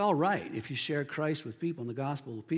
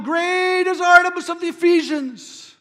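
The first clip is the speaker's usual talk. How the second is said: high, loud imitation of a rioting mob; crowd crying out; 13 semitones higher, 11 dB louder than usual. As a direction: cry it out like an enraged crowd. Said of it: "they're all crying out".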